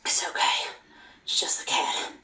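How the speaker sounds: hushed, voiceless self-reassurance; nervous whisper. Acted. Frightened transcriber after a noise from the cat, whispering. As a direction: whisper it to settle your own nerves.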